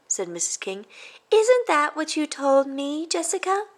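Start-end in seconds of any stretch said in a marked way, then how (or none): 1.3-3.7 gentle teacher. Acted. Missus King telling the class about Brutus. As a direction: break sad news to the class gently.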